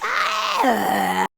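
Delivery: loud drawn-out wail; mock wail. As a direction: wail in hammed-up despair. Mock wail over the prince movie.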